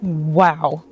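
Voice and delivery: normal talking voice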